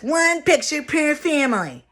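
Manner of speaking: high, loud, snippy announcement; bitchy elf. Acted. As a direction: lay down the rule bitchily. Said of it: "but he gets bitchy".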